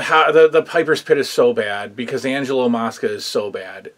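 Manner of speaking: ordinary talk, nothing marked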